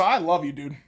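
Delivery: normal talking voice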